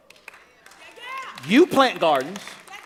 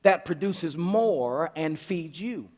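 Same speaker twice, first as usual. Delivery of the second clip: slow, deliberate; 4 semitones lower, 4 dB quieter, slower than usual. Retold or imitated. Preacher spelling out God's instruction slowly to congregation. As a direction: read it out very slowly. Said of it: "he says".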